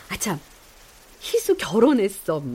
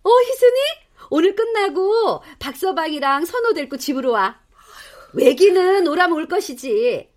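excited phone call; 7 semitones higher than usual. Acted.

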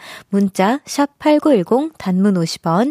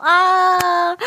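ordinary talk

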